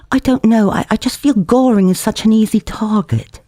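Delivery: over-plaintive actor. Acted. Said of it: "an over-plaintive rehearsal discussion voice".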